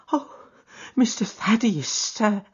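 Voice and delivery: put-on high, delighted old servant; a high old woman's happy welcome; old housekeeper. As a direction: greet the young master with joy. Old housekeeper greets Sholto.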